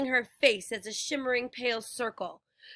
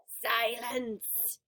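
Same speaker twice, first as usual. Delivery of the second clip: snarl; 4 dB quieter than usual. A narrator's reading. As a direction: snarl to shut her up. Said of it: "Medusa snarled".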